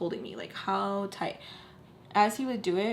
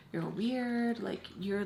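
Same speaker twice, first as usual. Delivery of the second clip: usual speaking voice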